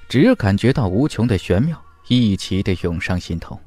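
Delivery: plain reading voice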